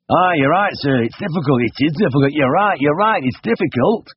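eager agreement. A narrator's reading. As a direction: agree as keenly as you can. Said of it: "replied eagerly".

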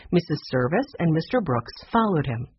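usual speaking voice

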